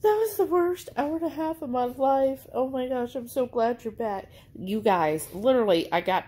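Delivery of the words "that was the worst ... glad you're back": high, whiny dog voice, complaining to mom; dog's voice. Pretend. Voicing the dog's reproach.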